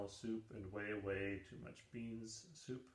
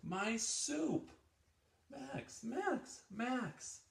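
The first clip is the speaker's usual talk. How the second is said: much higher, louder panicked wail; dismayed cry; 14 semitones higher, 4 dB louder, much slower than usual. Acted. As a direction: cry out as your soup is lost. Max loses his soup.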